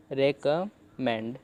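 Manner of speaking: Recommend stretched out slowly, re-kam-mend, with a clear final d; slow syllable demo. Shown as a demonstration. Teacher modelling the word for learners, very slow. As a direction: spell out recommend slowly by ear. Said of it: "ऐसे आप पढ़ सकते हो".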